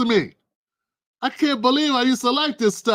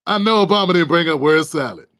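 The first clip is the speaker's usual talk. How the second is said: can't believe what he heard; disbelief. Real, not acted. Streamer reacting to Obama's word salad line, stunned.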